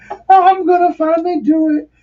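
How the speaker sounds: very high, giddy mock squeal; excited. Recalling his first stage dive, excited squeal. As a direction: squeal it like you can hardly wait. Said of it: "so excited".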